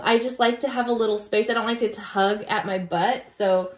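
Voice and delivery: usual speaking voice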